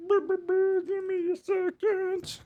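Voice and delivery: high, very slow drawn-out give me a second; silly sing-song stalling; stretched-out stall. Pretend. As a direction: stretch every word like a tape running slow. Stalling while fixing the mic.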